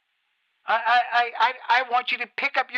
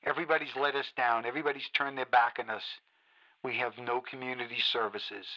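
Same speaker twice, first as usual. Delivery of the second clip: much lower and softer, bitter and drained; quiet seething despair; 9 semitones lower, 6 dB quieter than usual. Acted. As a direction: let the anger collapse into a low murmur. Host sinks into bitter despair.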